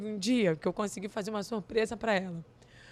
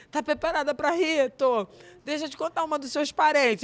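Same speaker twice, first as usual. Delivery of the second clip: higher, louder, gleeful setup of a joke; playful mother; 5 semitones higher, 6 dB louder than usual. Retold or imitated. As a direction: tease her before the punchline. Mother starting a funny story.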